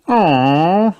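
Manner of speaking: high, disappointed "aw"; disappointed sigh. A narrator's reading. Children told to stay inside, disappointed sigh. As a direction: let out a child's let-down whine. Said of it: "sighs Peppa and George, disappointed".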